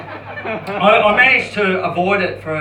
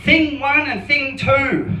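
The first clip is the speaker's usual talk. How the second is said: higher, louder, slow camp lilt; 7 semitones higher, 3 dB louder, slower than usual. Pretend. Hypnotherapist naming the twins, hammed-up camp voice. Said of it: "he goes".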